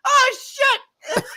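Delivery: high, loud yelped oh shit; mock yell as the piss bursts; startled curse. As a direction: yelp it in sudden shock. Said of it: "he's like".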